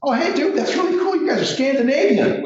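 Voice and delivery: high, eager surfer-dude impression; hammed-up high dude voice gushing; clueless dude voice. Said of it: "they're like".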